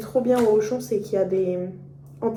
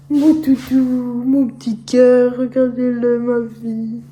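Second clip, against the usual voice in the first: cooing to a pet; 2 semitones higher, 7 dB louder, slower than usual. Real, not acted. Cooing to dog again.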